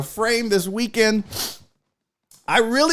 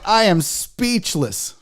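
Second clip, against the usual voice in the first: ordinary delivery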